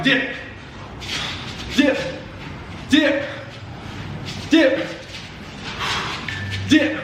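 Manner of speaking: sharp high called-out cue; boxing coach calling combos. Acted. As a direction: call it out like a coach. Coach calling dips in workout.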